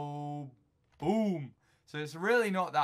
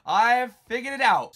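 excited; 7 semitones higher, 10 dB louder than usual. Real, not acted. Proud discovery, shouted out.

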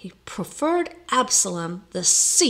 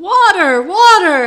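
frantic shouted cry, much higher and louder; yelling; 10 semitones higher, 14 dB louder than usual. A narrator's reading. Lodger with burning trousers yelling for water. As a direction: scream it as if on fire. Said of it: "Nicholas's voice yelling".

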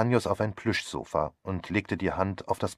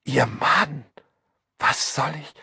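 high, loud, breathless fluster; flustered alarm; 7 semitones higher, 5 dB louder, slower than usual. Acted. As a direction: announce it in a fussy panic. Hostess panics as the husband arrives. (aufgeregt und hoch, alarmiert)

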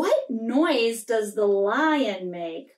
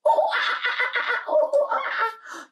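very high hooting monkey cackle; monkey shriek laugh; 12 semitones higher than usual. Pretend. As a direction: screech like a giggling monkey. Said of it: "this is what he said".

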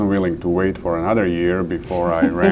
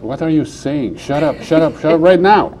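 rising from plain to loud, higher shut up; inner voice yelling; 7 semitones higher, 5 dB louder than usual. Retold or imitated. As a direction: scold yourself, louder each time. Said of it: "half of my mind was like".

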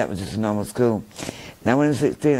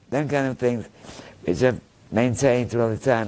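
ordinary talk

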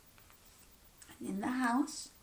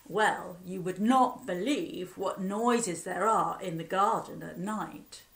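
usual speaking voice

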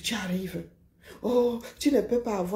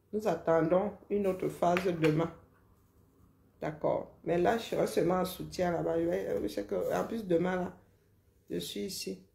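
normal talking voice

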